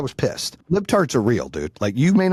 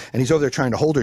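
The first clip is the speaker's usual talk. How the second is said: ordinary talk, nothing marked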